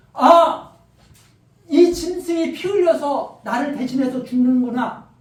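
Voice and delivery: loud, slow gasp of insight; awed realization. Acted. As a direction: cry out in sudden understanding. Voicing worshipper's realization. (깨달음에 놀라 외치듯)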